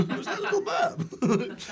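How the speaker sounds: high, laughing question. Real, not acted. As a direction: burst into a high-pitched laugh while asking. Reacting to own burp, amused.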